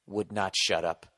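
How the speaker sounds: ordinary delivery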